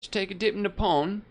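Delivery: lightly put-on British twang; mock British accent. Gamer imitating how Brits talk, mock British accent. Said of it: "I feel like they'd say something like that".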